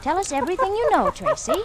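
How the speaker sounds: fast, high coaxing to a pet; urging the dog. Acted. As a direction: egg the dog on, quick and high.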